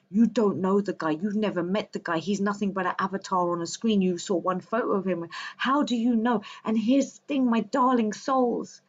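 ordinary talk, nothing marked

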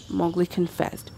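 ordinary delivery

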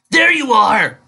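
high, loud call from above. A narrator's reading. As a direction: call down to her, bright and loud. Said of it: "called out Lightning Dust from above".

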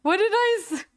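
high, loud, laughing words; real embarrassed laughter. Real, not acted. Streamer caught in a slip begging viewers, laughing. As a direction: laugh helplessly through the plea.

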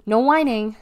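loud, firm rule; ordering. A narrator's reading. Deli warns R B, ordering firmly. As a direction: state the rule with force. Sister orders her brother.

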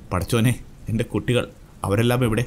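ordinary delivery, nothing marked

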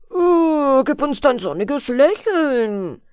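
slow, drawn-out boatmen's call. A narrator's reading. Boatmen beg the girl for her sunny smile, calling out. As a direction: call out eagerly in a put-on voice. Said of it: "riefen die Schiffer".

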